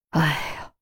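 soft, low exhaled sigh; long relieved sigh. A narrator's reading. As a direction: let the tension out in one quiet breath. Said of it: "严威长出口气". (长出一口气)

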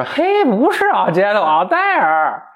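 high, slow correcting voice, cracking into laughter; hammed-up villainess, high and slow; mock Odile. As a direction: correct him in a silly drawn-out lady voice.

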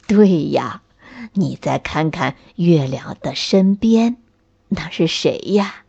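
put-on low, kindly old man; old tree grandpa voice. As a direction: grandfather pointing things out.

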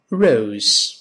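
ROSE with curled English r and long gliding o; correct Rose. Shown as a demonstration. Teacher gives an easy example, ROSE with English r. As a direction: model ROSE clearly, tongue tip curled back. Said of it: "舌尖勾回来".

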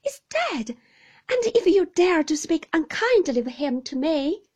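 high, loud indignant defence; hurt young widow flaring up. Acted. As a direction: defend him, voice rising in outrage.

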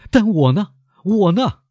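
high, desperate appeal; wounded, high-pitched outburst; anguished cry. A narrator's reading. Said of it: "莫雷尔痛苦地叫了起来".

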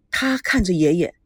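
usual speaking voice

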